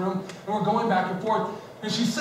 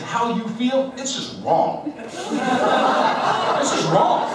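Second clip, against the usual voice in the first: laughing retort; 5 semitones higher, slower than usual. Retold or imitated. Retold mad reply, laughing.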